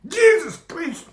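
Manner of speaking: high, slow startled exclamation; real shock. Real, not acted. Reacting to the heat of a chili, shocked. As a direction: gasp it out as the chili burns. After tasting a very hot chili.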